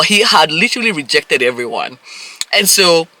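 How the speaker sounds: normal talking voice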